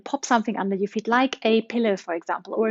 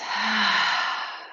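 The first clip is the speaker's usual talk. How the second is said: long audible sigh out; demonstration sigh; 3 dB quieter than usual. Shown as a demonstration. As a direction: breathe out in a big sigh. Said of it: "a big sigh out".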